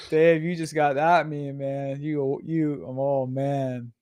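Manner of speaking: normal talking voice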